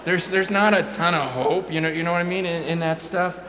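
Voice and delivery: ramped-up sermon delivery; preaching. Acted. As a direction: build into full preaching force. Preaching build, loud.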